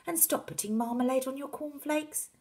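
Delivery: high, rising scolding; exasperated wife. Acted. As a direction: scold your husband, voice climbing. Wife scolds her excited husband.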